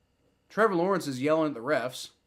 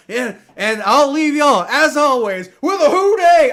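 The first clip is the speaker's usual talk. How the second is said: loud, high catchphrase rising to a shout; hyped video sign-off; 8 semitones higher, 11 dB louder than usual. Shown as a demonstration. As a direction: belt out your signature outro. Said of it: "You know how I'm like".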